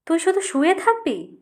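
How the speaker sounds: angry scolding. A narrator's reading. Mother scolds son lying down.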